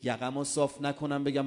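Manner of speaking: normal talking voice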